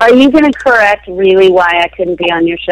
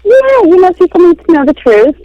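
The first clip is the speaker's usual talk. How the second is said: mock indignant; 12 semitones higher, 7 dB louder than usual. Joking indignant guest.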